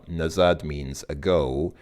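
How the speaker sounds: ordinary talk, nothing marked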